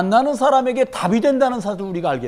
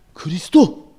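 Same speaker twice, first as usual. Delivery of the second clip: loud, wild cry of the name; acting crazy; 4 semitones lower, 4 dB louder, slower than usual. Shown as a demonstration. Preacher shows the crazy way to say it to clients. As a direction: blurt it out like you've lost your mind. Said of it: "막 정신 나간 척하고 계속 해보세요".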